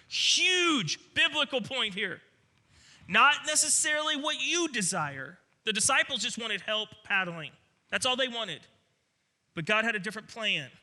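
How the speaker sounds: high, loud, emphatic preaching. Real, not acted. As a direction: drive the point home at full force. Preaching at full force.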